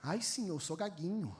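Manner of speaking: soft whimpering excuse; whiny stammerer. Pretend. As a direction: plead small and soft.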